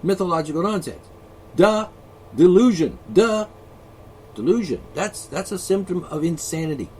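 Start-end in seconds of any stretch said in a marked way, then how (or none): 1.5-3.0 duh. Pretend. Mocking believers. 3.1-4.8 duh. Pretend. Mocking believers.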